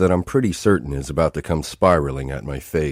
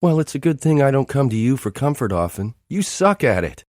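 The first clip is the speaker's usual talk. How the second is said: tearful retort; 9 semitones higher than usual. A narrator's reading.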